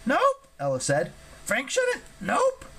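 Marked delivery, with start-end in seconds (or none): 0.1-0.5 very high, squeaky creature voice; Ella refusing. Acted. 1.4-2.7 very high, squeaky creature voice; Ella refusing. Acted.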